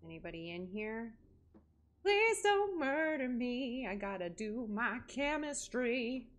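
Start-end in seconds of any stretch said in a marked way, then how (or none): 2.0-3.9 slow, higher, pleading; scared plea. Real, not acted. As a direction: beg the darkness to spare you. Scared entering dark room.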